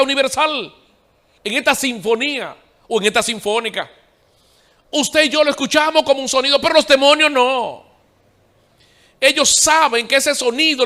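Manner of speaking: voz de predicador alta y aguda; prédica enérgica. Real, not acted. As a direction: proclama con fuerza, subiendo el tono. (predicación a plena voz)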